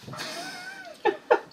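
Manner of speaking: squeaky little laugh; amused high laugh. Real, not acted. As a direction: giggle at your own joke. Laughing at own joke.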